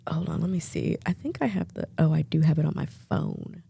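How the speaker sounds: soft, low mutter to self. Real, not acted. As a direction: talk to yourself under your breath. Muttering while searching phone.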